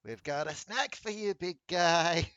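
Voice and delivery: lilting, teasing singsong; sing-songy voice. Acted. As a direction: coo it like a playful tune. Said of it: "with a sing-songy voice going".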